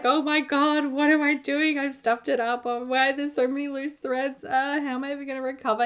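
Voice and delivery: replaying a flustered moment, starting high; retold stress. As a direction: act out a stressed, dramatic meltdown. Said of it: "I was very stressed at the time".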